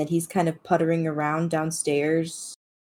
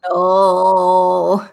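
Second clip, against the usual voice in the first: long, louder mock-desperate wail; 9 dB louder than usual. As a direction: howl it out as a cat left behind. Cat shut behind a door.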